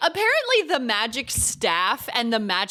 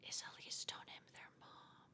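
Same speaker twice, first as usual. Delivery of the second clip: whisper; 26 dB quieter than usual. Real, not acted. Gasped realization, whispered aside.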